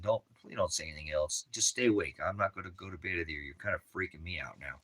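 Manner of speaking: hushed plea. Retold or imitated. Telling the spooked lady to stay quiet, hushed plea. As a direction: drop it low, almost pleading. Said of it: "I said".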